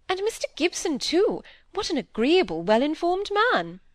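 lively, confident peer's daughter; outspoken lady visitor. Acted. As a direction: talk over her objections cheerfully. Lady Harriet argues cheerfully.

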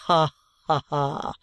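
short hammed-up laugh; mock laugh. Trooper laughing off the old man's flattery. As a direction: laugh it off coolly.

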